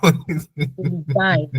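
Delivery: put-on slow, drawn-out Polizei in an Indian accent. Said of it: "Indian accent".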